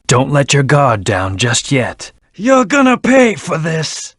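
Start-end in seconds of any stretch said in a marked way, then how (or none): 2.3-4.2 high, slow, drawn-out vow of revenge; furious battle taunt, stretched out; angry threat. Acted. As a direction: spit the threat out at the enemy. Threat shouted mid-battle.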